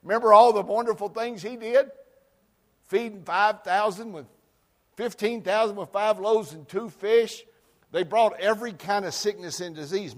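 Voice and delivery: loud, high, ringing preacher voice; preaching at full force. Acted. Pastor stirring up the congregation, loud and high. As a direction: preach it big, building.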